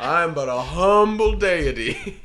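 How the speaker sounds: high, loud humble god, laughing; humble deity voice. Pretend. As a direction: play a meek little god. Voicing humble local god.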